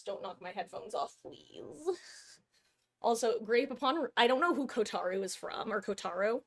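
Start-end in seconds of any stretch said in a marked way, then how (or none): none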